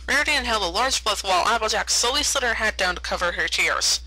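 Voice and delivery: plain reading voice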